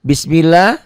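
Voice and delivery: Bismillah with a light, thin L in "lah"; thin Allah. Shown as a demonstration. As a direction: say Bismillah with a clear, unthickened "lah". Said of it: "lapad Allahnya dibaca tipis".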